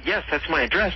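normal talking voice

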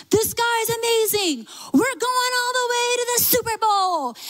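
lightly voiced fans hyping their quarterback; excited fan. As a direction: gush like a hometown fan.

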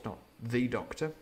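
ordinary delivery, nothing marked

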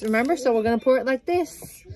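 ordinary talk, nothing marked

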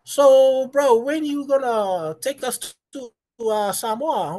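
very high-pitched mock girl voice; loud, exaggerated high girly pleading; imitated homegirls. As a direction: mimic eager girl friends, high and drawn out. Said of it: "I tell that to all my homegirls".

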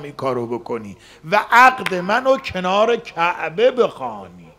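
loud, pressing prayer; pleading with God for a bride, loud; loud earnest plea. Retold or imitated. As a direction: keep insisting to God, loudly. Retold prayer continues.

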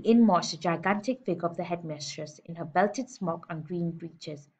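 ordinary delivery, nothing marked

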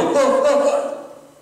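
high, breathy panic yelps with a laugh; mock Barney Fife fluster; panicked oh. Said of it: "and you go".

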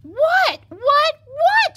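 very high, loud repeated demand; exasperated shout. Acted. Fox is interrupted by the piglet, shouting. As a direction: shout each word sharply in exasperation. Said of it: "shouted the fox".

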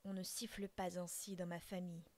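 plain reading voice

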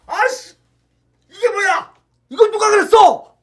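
high, loud disgusted shout; outraged yell. Acted. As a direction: shout it out, appalled. Parent finds poop, outraged.

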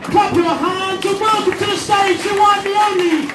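high, full-throated stage introduction; roaring MC build-up; hype announcer. Real, not acted. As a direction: shout the act on, stretching out the name. MC announces the next act.

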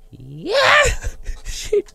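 high, loud shriek then laugh; startled yelp. Real, not acted. Gamer startled by a creeper in the dark. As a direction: jump out of your skin. Jump scare.

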